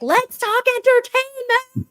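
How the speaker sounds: hammed-up showbiz pitch; mock showbiz voice. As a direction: stretch it out like a gushing host. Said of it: "I'll be like".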